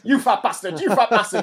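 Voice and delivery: mock shout of an insult. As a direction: bellow it out at him.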